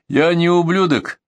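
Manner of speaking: slow, high, offended denial; indignant retort. A narrator's reading. Chechen rejects the bandit's insult, high and indignant.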